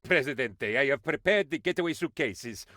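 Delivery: normal talking voice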